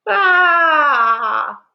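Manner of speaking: high, loud shriek like a cat; shrieking cry. A narrator's reading. Mother finds her daughter's note gone, loud shriek. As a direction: let out a panicked cat-like shriek. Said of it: "Ma made a noise like a shrieking cat".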